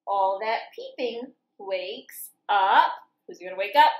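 lively sing-song picture book reading; reading to children. A narrator's reading. Teacher reading farm story to children, lively sing-song. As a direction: read it bouncy, like for little kids.

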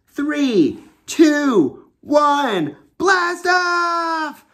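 high, loud launch countdown building to a cry; excited countdown. Acted. Launch countdown for the kids, rising to an excited shout. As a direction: count down like mission control, blast off big.

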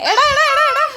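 high, loud repeated call. Real, not acted. Mother trying to distract her crying baby, high insistent calling.

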